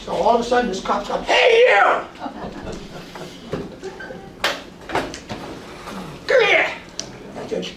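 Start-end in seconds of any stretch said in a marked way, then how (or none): 1.2-6.7 cop yelling. Retold or imitated. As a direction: bark it like a cop calling a suspect. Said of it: "he's, like, yelling at me".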